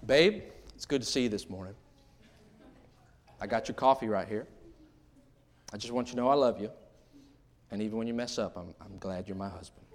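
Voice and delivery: soft, low and tender; soft loving morning words to husband. Shown as a demonstration. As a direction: speak gently to your husband. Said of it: "try this one today".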